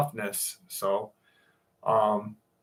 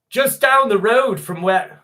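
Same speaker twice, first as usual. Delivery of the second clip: a bad, hammed-up Aussie accent, louder and higher; terrible Australian accent; 9 semitones higher, 6 dB louder than usual. Streamer attempting an Aussie accent for down-under viewers, badly. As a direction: botch an Australian accent like a drunken Englishman. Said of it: "that's fucking a terrible Australian accent".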